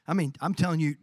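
usual speaking voice

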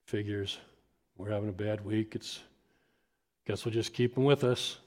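quiet, defeated mutter; resigned sigh. Retold or imitated. Couple answering the empty kids' area, resigned. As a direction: give up under your breath. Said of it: "kinda look at each other and just go".

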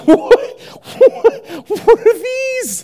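high, stunned disbelief at his own legs; astonished. Retold or imitated. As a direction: gasp it out, amazed and squeaky. Healed man staring at his legs.

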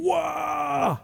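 high, drawn-out whoa of wonder; awestruck whoa. Acted. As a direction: marvel at something huge. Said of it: "Was it big? God intervening".

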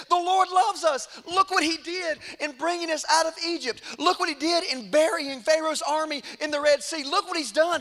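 high, insistent preacher's exhortation; impassioned rallying. Retold or imitated. Caleb urging Israel to take the promised land, impassioned rallying. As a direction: build the case up to a loud call.

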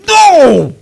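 loud, high, drawn-out protest; hammed-up cry of royal disbelief; outraged king. King hears the people were blessed, outraged shout. King's outburst at the prophet's blessing.

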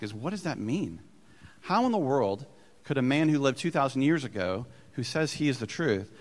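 usual speaking voice

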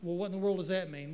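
normal talking voice

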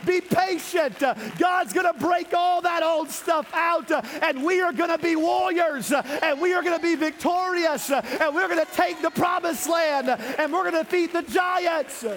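high, loud, rhythmic preacher cadence; full-force preaching. Acted. Preacher urging the church through the wilderness, loud and high.

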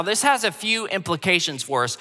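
ordinary delivery

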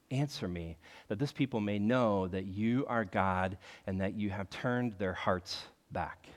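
quiet, slow humble plea to God; sincere humble prayer. Retold or imitated. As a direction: pray it softly, small before the Lord. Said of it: "his prayer is very genuine".

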